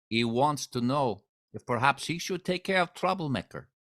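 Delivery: bad Russian accent, lightly done; broken English with Russian accent. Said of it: "my really bad Russian accent".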